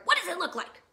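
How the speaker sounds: loud, high, exasperated retort; snapping. Acted. Grumpy Bird fed up with Raccoon's question, loud snap. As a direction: throw the question back sharply. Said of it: "snapped Bird".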